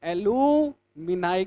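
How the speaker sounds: "एलुमिनाई" slowly, stretching the middle oo; slow teaching model of "एलुमिनाई" with final -nai; demonstrated plural pronunciation. Shown as a demonstration. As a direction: draw out "एलुमिनाई", ending on eye. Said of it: "यूएस को हटा के क्या करेंगे".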